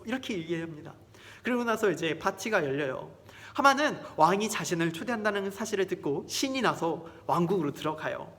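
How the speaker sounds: ordinary delivery, nothing marked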